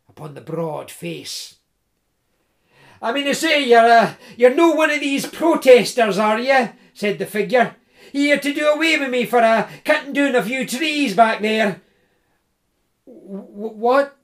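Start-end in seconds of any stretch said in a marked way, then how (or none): 3.0-11.8 big hearty Scots voice, louder and higher; jovial Scottish woodsman. Acted. Tree surgeon teasing Jim in the dark woods, hearty Scots. 13.1-14.2 startled, stumbling, a bit higher; nervous stammer. Acted. Jim caught off guard by the big man, stammering.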